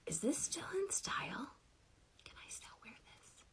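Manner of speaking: hushed whisper, fading to almost nothing; sheepish retold whisper; whispered question. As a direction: whisper it like a secret check. Retold secret text to fashionista friends.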